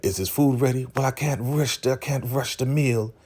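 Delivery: high-pitched imitation of kitchen bickering; acted high, protesting voice; retold fussing. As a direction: pitch it up as if pestered over dinner. Acts argument over hurrying the food.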